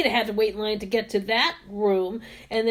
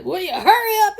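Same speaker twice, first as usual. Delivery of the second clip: laughing mock yell; 8 semitones higher, 5 dB louder, faster than usual. Retelling the impatient man.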